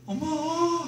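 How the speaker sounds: put-on high, whiny cry of a child; little son running to his mother; child calling mom. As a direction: call out for mom like an upset kid. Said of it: "하면서".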